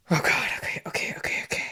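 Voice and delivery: quick whispered self-soothing; nervous whisper. Real, not acted. Player afraid to mess up the choice, nervous whisper. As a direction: mutter okays under your breath.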